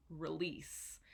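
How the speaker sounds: release, first vowel a soft schwa, stress on LEASE; release, quiet ruh-LEASE; schwa demo. Shown as a demonstration. Teacher models the schwa in release softly. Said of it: "This is the schwa".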